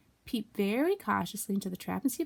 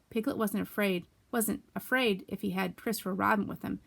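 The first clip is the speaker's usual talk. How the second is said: usual speaking voice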